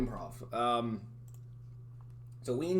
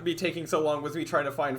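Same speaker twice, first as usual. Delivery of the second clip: ordinary delivery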